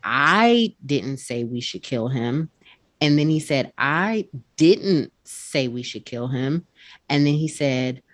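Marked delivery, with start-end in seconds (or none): 0.0-2.5 "I" punched, rest slow and even; stress on I. Shown as a demonstration. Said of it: "he put more emphasis on one word". 3.8-6.7 "didn't" hit hard, slow delivery; stress on didn't. Shown as a demonstration. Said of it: "he put more emphasis on one word".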